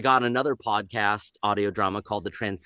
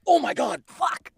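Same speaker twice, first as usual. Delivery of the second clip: inner panic. Retold or imitated. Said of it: "And I'd be like".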